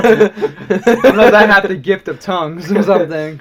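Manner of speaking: laughing through the words; laughing denial. Real, not acted. Laughing off Josh's Mandarin joke, real laughter. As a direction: deny it while cracking up. Joking about gift of tongues.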